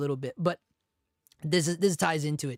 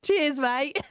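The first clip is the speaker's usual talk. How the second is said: higher, badly faked Australian accent; 8 semitones higher than usual. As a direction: toss out a clumsy mock-Australian sign-off. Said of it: "you need to work on your Australian".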